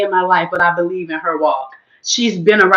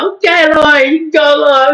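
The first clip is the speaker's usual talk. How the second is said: excited reaction; 6 semitones higher, 4 dB louder than usual. Retold or imitated. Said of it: "I'm like".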